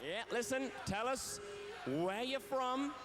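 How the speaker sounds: usual speaking voice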